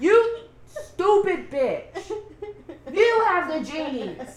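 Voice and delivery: slow, loud mock insult; mock angry. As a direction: hurl it slowly and loudly. Mock insult voicing Betty.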